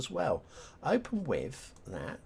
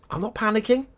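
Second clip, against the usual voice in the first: high, loud, rising denial of panic; mock panic; 11 semitones higher, 8 dB louder, faster than usual. Real, not acted. Streamer as the game loads, panicky denial. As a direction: insist too brightly, pitch shooting up. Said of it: "I'm panicking".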